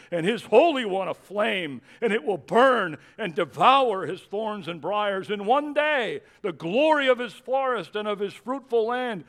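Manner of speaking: loud, high, soaring scripture reading; preacher at full cry; full-force preaching. Acted. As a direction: thunder the prophecy out.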